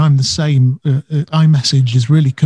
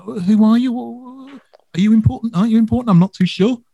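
confused gatekeeper; 7 semitones higher than usual. Retold or imitated. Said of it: "So they're a bit like".

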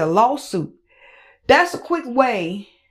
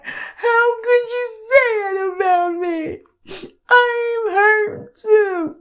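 much higher, whimpering sob-voice mockery; mock fake crying; 11 semitones higher than usual. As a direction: parody her crocodile tears. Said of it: "then you sit up and cry".

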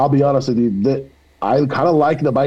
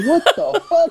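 squeaky high what the fuck, laughing through it; mock-shocked, pitch shooting way up; shocked disbelief. Guy telling the story of the remark, squeaky shocked laugh. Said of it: "I'm like".

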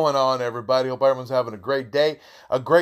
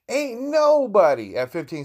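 incredulous; 9 semitones higher than usual. Real, not acted. Same outraged disbelief.

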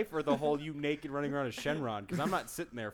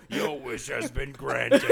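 Shenron voice; 4 dB louder, slower than usual. Pretend. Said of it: "You go".

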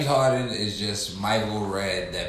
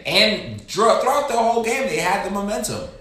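much higher, louder emphatic rant; excited rant; 11 semitones higher, 7 dB louder than usual. Real, not acted. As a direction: push the point up high.

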